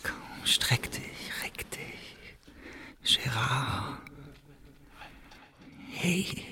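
slow, soft whisper to wake him; hushed bedside whisper; coaxing whisper. Acted. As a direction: coax a sleeper awake in a hush. Waking Gérard gently to tell story.

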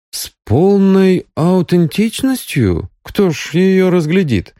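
high-pitched incredulous questioning; surprised. A narrator's reading. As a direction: question the need in amazement. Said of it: "удивился директор".

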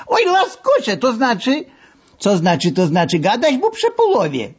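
put-on high, playful Lwów-accented threat; mock menacing masseur. A narrator's reading. Masseur playfully threatens the engineer on the couch. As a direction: threaten him like a jovial bully.

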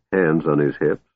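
ordinary delivery

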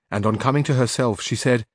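plain reading voice

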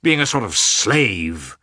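exasperated husband, pitch jumping; exclaimed annoyance. A narrator's reading. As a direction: burst out irritably, then drag the last words. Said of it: "unable to restrain his annoyance".

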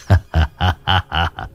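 put-on low creepy old man's chuckle; sinister chuckle. A narrator's reading. Demon abbot mocking the girl, sinister low chuckle. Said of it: "nụ cười dị hợm".

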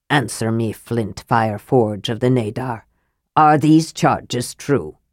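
low, grim, measured demand; grim tones. A narrator's reading. Thane questions the dwarf about the charges, grim. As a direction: question him gravely, low, slowing at the end. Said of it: "Hornfell said in grim tones".

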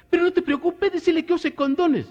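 very high falsetto advice; high friend voice. Acted. Comedian voicing the friend telling him not to worry, in very high falsetto.

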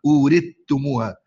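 أورثتموها بتاء مشددة بدل الثاء; أورثتموها بإدغام الثاء في التاء المشددة; إدغام الثاء في التاء. Shown as a demonstration. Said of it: "يحولها إلى تاء مشددة". (نطق أورثتموها بالإدغام)

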